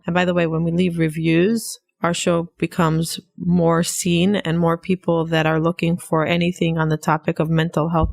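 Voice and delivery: ordinary talk, nothing marked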